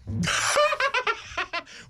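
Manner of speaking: high sudden laugh; burst of laughter. Real, not acted. Host laughs at guest's joke.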